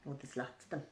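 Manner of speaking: plain reading voice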